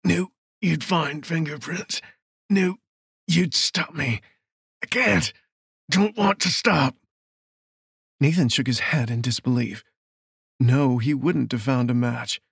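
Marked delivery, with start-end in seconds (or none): 0.0-6.9 higher, strained voice in broken phrases; crazed killer. Acted. Pinned killer confessing to the lawman, crazed and strained. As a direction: let the madness leak out haltingly.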